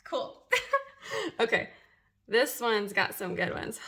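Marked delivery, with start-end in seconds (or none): 0.5-1.3 bright high burst of laughter; high giggle. Real, not acted. After hairs remain, high giggle.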